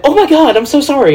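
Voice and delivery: high-pitched flustered apology, imitated; mock apologetic woman. Embarrassed woman apologizing to the man she misjudged, mock flustered. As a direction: jump up high like a mortified woman. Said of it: "And she went".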